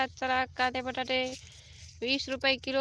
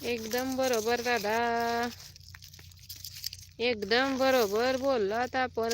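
slow, deliberate, drawing out बरोबर; stretched-out approving nod in the voice; slow emphatic agreement; slower than usual. Real, not acted. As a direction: agree slowly, leaning on every word. Agreeing emphatically with Ganesh dada.

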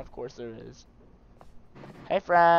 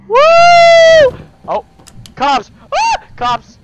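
loud, sudden startled yells; startled yelling; 20 dB louder, much slower than usual. Real, not acted. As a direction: yelp in surprise at the cops. Player startled by cops in game.

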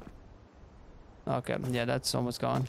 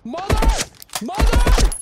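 panicked scream; 13 dB louder, much slower than usual. Real, not acted. Panicked yelling in game.

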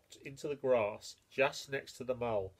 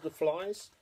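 plain reading voice

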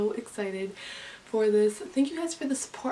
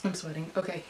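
normal talking voice

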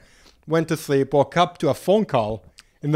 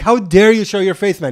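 higher, louder indignant snap; angry retort; 5 semitones higher, 4 dB louder than usual. Retold or imitated. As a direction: tell him off with outrage. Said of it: "very angry".

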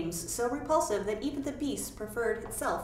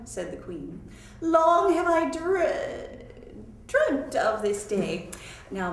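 grand queen voice; 3 semitones higher, 4 dB louder, slower than usual. Acted. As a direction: speak as a stately, doting queen. Queen answers her son.

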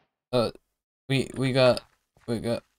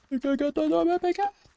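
much higher, fast excited squeal; 3 dB louder, faster than usual. Real, not acted. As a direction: squeal it out with glee. Excited discovery, then repeated squeal.

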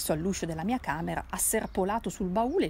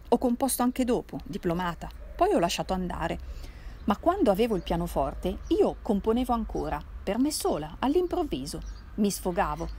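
ordinary delivery, nothing marked

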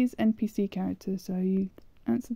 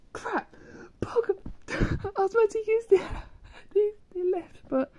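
squealing high with laughter; high-pitched shriek; 12 semitones higher than usual. Real, not acted. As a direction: shriek it through laughter at a blunder. Game blunder, shrieking and laughing.

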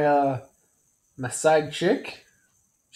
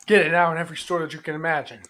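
fast ad read; 3 semitones lower, much faster than usual. Acted. Fast mock commercial tag line.

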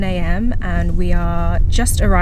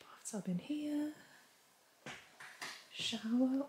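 whisper; 5 semitones higher, 26 dB quieter than usual. Real, not acted. Quiet exploring of the cabin.

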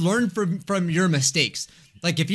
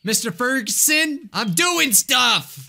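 lightly voiced grumpy neighbor; 7 semitones higher than usual. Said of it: "He's just like".

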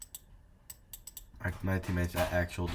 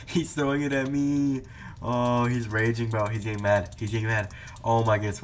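loud, higher excited play-by-play; 3 semitones higher, 6 dB louder than usual. Real, not acted. Reacting to a raging opponent, loud and thrilled.

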